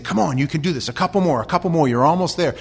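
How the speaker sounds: a coach yelling encouragement right beside his player; coach urging him on. Retold or imitated. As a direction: push him through the last yards. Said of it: "getting down next to him and saying".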